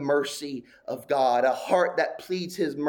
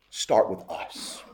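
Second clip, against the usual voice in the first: hushed; 8 semitones lower, 6 dB quieter, slower than usual. Acted. Closing appeal trailing to hush.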